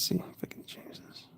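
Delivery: quick, soft whisper to herself. Real, not acted. To herself while fixing the drawing, a quick whisper. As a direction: murmur it fast under your breath.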